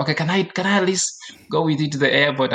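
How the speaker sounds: pleading mother. Retold or imitated. Mom asking to go along to the airport, pleading. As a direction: lightly voice a mom asking hopefully.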